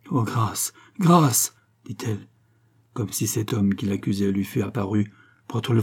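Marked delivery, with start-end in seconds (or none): 0.1-1.5 terrified plea. A narrator's reading. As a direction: plead for mercy, more urgent on the repeat. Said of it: "la terreur domina chez la gitana". (supplication terrifiée)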